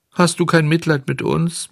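high, pleading question; wailing fox. A narrator's reading. Fox begs Bengele for pity, wailing. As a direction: beg for pity in a whine. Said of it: "So heulten die zwei Räuber".

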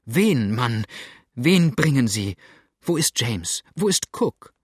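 loud, urgent questions, voice climbing on Cook; frantic women pressing old man for answers; frightened demand. A narrator's reading. Women demanding news of their husbands, frantic.

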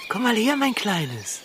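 coaxing. Acted. Luring the bunny gently.

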